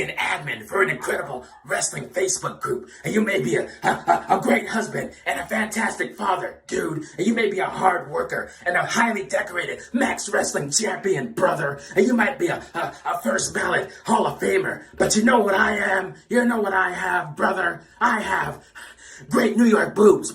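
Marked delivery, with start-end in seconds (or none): none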